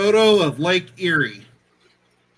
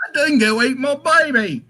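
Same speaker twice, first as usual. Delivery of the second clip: put-on higher, laughing Australian accent; laughing Australian accent, pitched up; mock Australian woman; 8 semitones higher than usual. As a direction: imitate the Australian woman's famous line. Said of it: "it's a woman that says that line".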